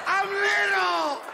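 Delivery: high, slow put-on aunt voice; drawn-out high aunt reply; aunt's voice. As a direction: play the tipsy aunt insisting. Aunt answers in her voice.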